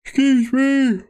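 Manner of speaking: words stretched through a big yawn. Real, not acted. Streamer yawning mid-stream while picking his team. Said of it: "Big yawns there".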